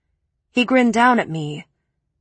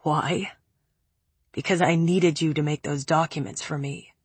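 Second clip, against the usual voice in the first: low, raspy and quieter; 4 semitones lower, 4 dB quieter than usual. Acted. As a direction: rasp it out, worn down. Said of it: "His voice was raspy".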